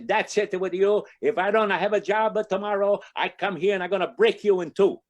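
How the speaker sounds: high, loud mock threat in Italian accent; angry Italian accent. Jobless Italian guy threatening Saint Anthony's statue, mock-angry. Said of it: "he gets angry".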